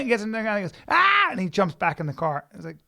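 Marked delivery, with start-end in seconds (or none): 0.9-1.3 high, loud mock battle cry; short yell, high and loud; imitated attack yell. Friend tackling the guy in the bear suit, imitated yell.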